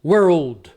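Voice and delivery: put-on "world" said oddly as "were-old"; firm demo of "world" with a full "old" in it; fuse "were" and "old" into "world"; two-word mispronunciation. Shown as a demonstration. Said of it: "Fica estranho".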